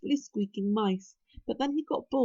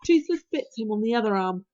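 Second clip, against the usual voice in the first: plain reading voice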